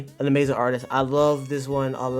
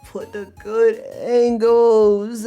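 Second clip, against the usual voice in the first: ANGLES stretched long, high and louder; hyped emphasis; 7 semitones higher, 5 dB louder, much slower than usual. Real, not acted. Streamer praising the artist to chat, stretching ANGLES emphatically. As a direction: draw out "good angles" like an announcement.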